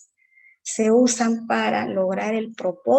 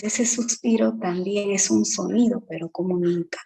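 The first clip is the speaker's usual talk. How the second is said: ordinary talk, nothing marked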